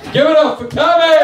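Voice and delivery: high, loud hype-man yell; rallying shout. Real, not acted. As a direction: shout to whip the crowd into applause. Repeats call, demanding louder applause.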